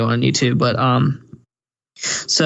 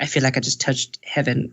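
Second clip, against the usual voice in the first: softly swooning simp voice; imitating a smitten simp, softer; mock dreamy simp; 4 dB quieter than usual. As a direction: swoon over her, softer. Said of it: "He's like".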